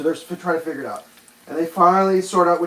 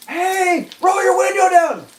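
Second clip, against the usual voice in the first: high, loud call to the next car; 4 dB louder, slower than usual. Retold or imitated. Re-enacting calling to the driver, high and loud. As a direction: shout it across again. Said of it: "I'll do it again".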